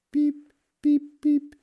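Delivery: tiny, very high squeaks; duckling peeps. A narrator's reading. Ducklings answer their mother, peeping. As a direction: peep back like baby ducks. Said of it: "sagten die Kinder".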